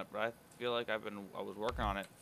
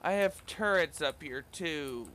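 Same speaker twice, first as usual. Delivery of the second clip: whiny; 6 semitones higher, 7 dB louder, slower than usual. Real, not acted. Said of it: "I sound like a whiny bitch".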